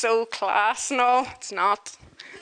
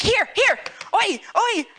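calling out; 10 semitones higher, 3 dB louder than usual. Retold or imitated. Shooing the camel off.

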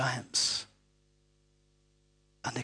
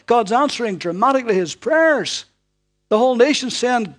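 loud, high, ringing preacher's voice; preaching at full force; 8 semitones higher, 8 dB louder than usual. Acted. Preacher booming the story to the congregation, full force. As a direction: proclaim it from the pulpit.

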